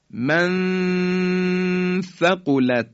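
«من ثقلت» joined by a long high nasal hum; very slow «من ثقلت», level drawn-out ghunna; ikhfa. Shown as a demonstration. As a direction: hold the hidden noon of «من» before «ثقلت».

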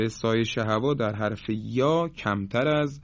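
usual speaking voice

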